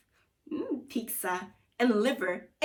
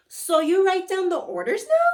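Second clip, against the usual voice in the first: put-on higher, louder girl's question; Gloria's question; 9 semitones higher, 9 dB louder than usual. Gloria asking the wizard about the orders, high and loud. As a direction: ask it high and eager. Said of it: "she asked".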